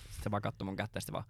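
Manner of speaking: ordinary delivery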